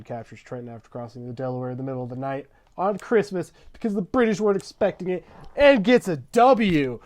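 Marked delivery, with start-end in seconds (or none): 2.8-7.0 high, loud celebratory yell; crowing, over-the-top excitement; triumphant hype. Acted. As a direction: build to a shouted victory call. Triumphant hype of Christmas victory.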